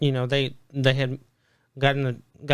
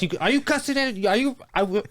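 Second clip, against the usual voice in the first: imitating coach; 7 semitones higher, faster than usual. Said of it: "he goes".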